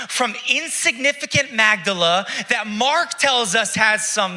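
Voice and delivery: high, loud preaching build; climbing sermon passion; preaching at full force. Acted. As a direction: rise up and preach it out.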